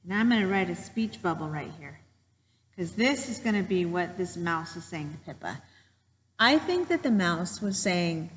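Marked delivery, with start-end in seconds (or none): none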